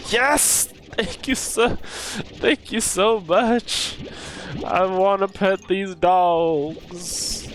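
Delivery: high, slow, gushing thanks; overjoyed thanks. Retold or imitated. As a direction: gush with delight.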